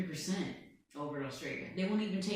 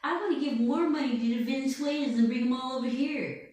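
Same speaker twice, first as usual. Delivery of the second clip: higher, lightly mocking old-man voice; Biden; 6 semitones higher, 4 dB louder than usual. Trump mimicking Biden on the golf course, higher mock voice. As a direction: needle him with a thin mock Biden. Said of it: "He said".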